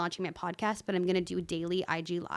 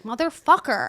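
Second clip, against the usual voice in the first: a loud, higher frustrated curse; frustrated scream; 4 semitones higher, 11 dB louder than usual. Retold or imitated. Podcaster reenacting her angry outburst, loud curse. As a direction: let the curse burst out in anger. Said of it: "and I just scream".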